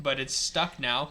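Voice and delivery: usual speaking voice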